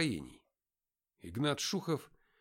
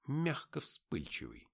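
ordinary delivery, nothing marked

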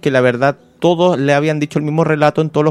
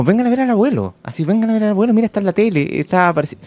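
grandfather calling grandchildren; 10 semitones higher than usual. Retold or imitated. Torturer calls his grandchildren to see him on TV, imitated high-pitched calling. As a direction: imitate an old torturer eagerly summoning the kids. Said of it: "y llama a sus nietos".

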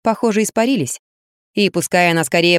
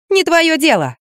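sharp retort; 11 semitones higher, slower than usual. Acted. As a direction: shut him down hard. Snapping back at him.

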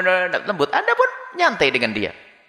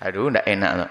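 lower, uneasy hesitation; a lower, awkward "I'd rather not"; reluctant sigh; 10 semitones lower, 4 dB quieter than usual. Retold or imitated. Friend who is reluctant to visit the host, lower uneasy reply.

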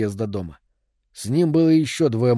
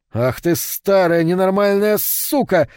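slow, loud, higher insult shouted back; yelling back; 6 semitones higher, 3 dB louder, slower than usual. A narrator's reading. Rico yelling back at the old woman, slow loud shout. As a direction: bellow it at her, word by word. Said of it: "заорал в ответ Рико".

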